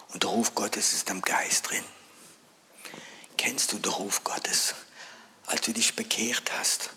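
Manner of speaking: soft, low, half-whispered sermon voice; hushed preaching. Acted. Preacher pleading quietly with the congregation, hushed and low. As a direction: draw the listener in with a hush.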